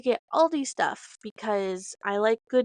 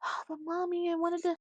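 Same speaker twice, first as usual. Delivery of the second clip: put-on higher, softer pleading kid; lightly voiced little girl begging; whiny child; 7 semitones higher, 4 dB quieter, faster than usual. Daughter begging mom to stay, soft whiny child voice. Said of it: "And she's like".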